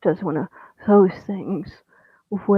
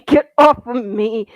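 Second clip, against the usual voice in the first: loud struggling shout, slightly less high; 3 semitones higher, 6 dB louder than usual. Acted. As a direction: keep yelling while squirming. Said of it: "squirming and growling".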